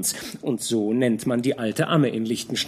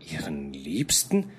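tonlos, tiefer geflüsterte Frage; erschrockenes Flüstern; 10 semitones lower, 5 dB quieter than usual. Acted. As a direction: fassungslos hauchen. Georg erschrocken nachfragend. (Georg fragt die Wirtin entsetzt nach, geflüstert)

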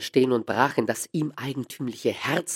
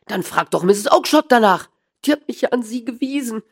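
whining protest; 11 semitones higher, 8 dB louder than usual. A narrator's reading. Small man pleading with angry dealer.

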